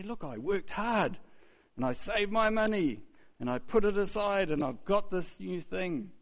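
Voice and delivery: high, pleased bragging voice; mock bragging. Preacher imitating someone bragging about a purchase, mild. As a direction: show off the new thing lightly.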